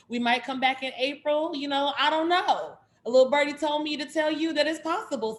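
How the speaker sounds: high, loud, coy singsong; playful teasing hint. Real, not acted. Host hinting the series might return in April, playfully coy.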